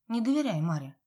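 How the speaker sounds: usual speaking voice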